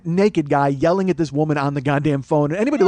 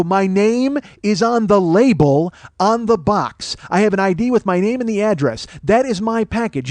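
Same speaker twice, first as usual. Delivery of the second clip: loud, high, emphatic argument; indignant, drawn-out insistence; angry insistence; 5 semitones higher, 4 dB louder than usual. Retold or imitated. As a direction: hammer each point home, raised voice. Retold angry phone argument.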